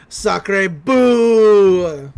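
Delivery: loud, long-held mock-French boo; hammed-up French exclamation. French golf balls booing Mabel, hammed-up jeer. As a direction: jeer loudly like cartoon French characters. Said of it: "line from the French ones".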